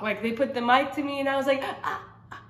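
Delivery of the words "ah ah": whispered strangled ahs; choked, can't speak. Retold or imitated. Woman at the altar mic unable to speak, choked whisper. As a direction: struggle to get a word out. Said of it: "at first I couldn't talk".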